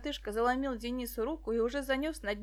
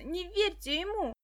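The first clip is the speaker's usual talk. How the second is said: pleading; 7 semitones higher than usual. Acted. Dasha pleading.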